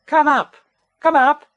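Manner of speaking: high, brisk call. Acted. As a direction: call high and a bit loud to someone below. Bear calling down the tree.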